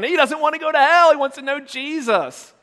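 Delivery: put-on high-pitched childlike wailing report; hammed-up, high emotional cry; mock tearful plea. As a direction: plead it like an overwrought kid. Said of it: "Mark's crying".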